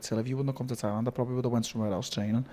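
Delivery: soft and quick, heartfelt; quiet retold Brendan. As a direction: murmur it like a grateful friend. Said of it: "He was like".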